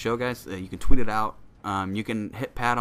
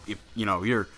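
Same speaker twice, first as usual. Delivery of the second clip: ordinary talk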